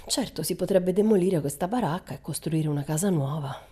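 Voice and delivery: plain reading voice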